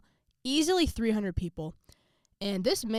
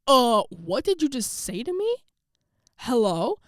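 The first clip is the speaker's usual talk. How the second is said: high, loud, slow "what did you just say to me"; drawn out, stunned and offended; outraged disbelief; 5 semitones higher, 8 dB louder, slower than usual. Acted. Shocked reaction to coach's insult.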